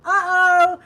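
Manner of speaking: very loud, high "uh-oh"; sudden loud exclamation of dismay; alarmed uh-oh. Real, not acted. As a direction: blurt it out as something topples. Candle suddenly falls mid-stream.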